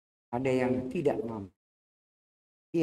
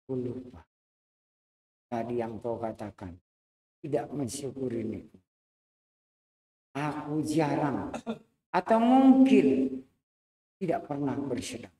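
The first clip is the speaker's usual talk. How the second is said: remorseful confession. Acted. Master confesses to servant, crying.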